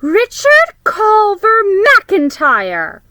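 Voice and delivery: full-name scolding like a stern big sister; bossiest voice. Acted. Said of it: "she said with her bossiest voice".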